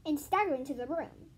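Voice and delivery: plain reading voice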